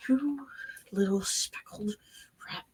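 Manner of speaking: whispered threat leaned in close; hushed and cold; menacing whisper. Acted. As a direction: breathe the insult with quiet menace. Character menaces the rat.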